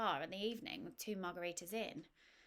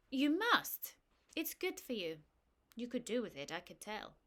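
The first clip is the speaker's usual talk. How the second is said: put-on French accent; 4 semitones higher, 6 dB louder than usual. French woman insists, voiced accent.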